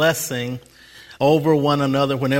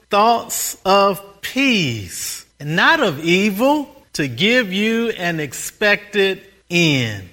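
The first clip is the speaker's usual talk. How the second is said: slow scripture quote; 3 semitones higher, slower than usual. Acted. Quoting scripture with weight.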